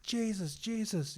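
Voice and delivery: very high-pitched mock shout; acting out a blind man's cry; imitated shouting. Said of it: "he just starts shouting".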